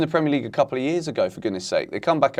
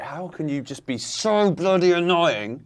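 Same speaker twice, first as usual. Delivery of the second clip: slow, higher-pitched exasperation; annoyed; 6 semitones higher than usual. Real, not acted. Fan fed up with Brighton over a drawn-out transfer, annoyed. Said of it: "I'm annoyed with them".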